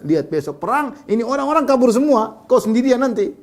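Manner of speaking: high-pitched retold taunt; mocking jibe. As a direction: jeer that his men will desert him.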